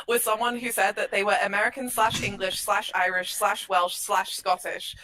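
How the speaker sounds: usual speaking voice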